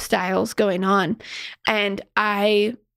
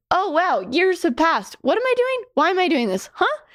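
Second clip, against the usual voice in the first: mock bewildered; 9 semitones higher than usual. Bewildered self-talk mid-life.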